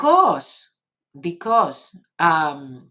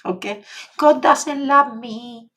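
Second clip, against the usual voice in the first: whiny victim voice; 4 semitones higher, slower than usual. Pretend. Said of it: "Or you're gonna".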